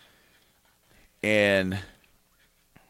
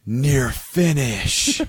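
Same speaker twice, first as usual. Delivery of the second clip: very slow, stretched-out stoned awe; mock stoned slow-motion exclamation; slow-motion popping; much slower than usual. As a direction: drag out both words in dazed wonder. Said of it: "the most slowest of manner".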